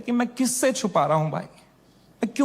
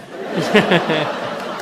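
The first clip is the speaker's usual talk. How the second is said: burst of laughter; 2 semitones lower, 4 dB louder than usual. Real, not acted. Laughing while urging kisses.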